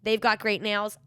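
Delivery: usual speaking voice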